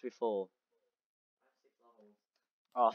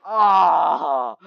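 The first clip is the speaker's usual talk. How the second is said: pained yelp; 5 dB louder than usual. Real, not acted. Hit in game fight.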